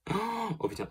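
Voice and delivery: a sudden high burst of surprise; startled as the powder seems to break; real surprised gasp. Real, not acted. High, startled cry over the fragile blush.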